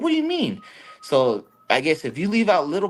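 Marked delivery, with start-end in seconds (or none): 0.0-0.6 much higher incredulous question; high-pitched startled question; mock shock. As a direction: act out the shocked reply. Teller's shocked reply.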